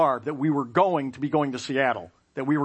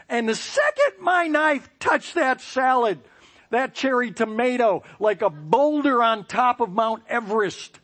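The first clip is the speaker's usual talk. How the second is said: higher and louder, building the disaster; excited storytelling; 9 semitones higher, 4 dB louder than usual. Real, not acted. Narrating the tomato's slide to the audience, breathless and excited. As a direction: tell the tomato's fall like an avalanche.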